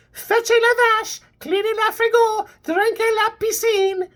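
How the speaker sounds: high, whiny mock French accent; French accent whine. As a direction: scold in a shrill French whine. Hannah continues French whine.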